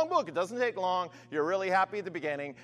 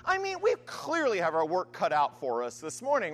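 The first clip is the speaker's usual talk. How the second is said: ordinary talk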